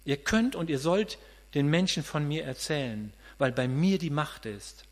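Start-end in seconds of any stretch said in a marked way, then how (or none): none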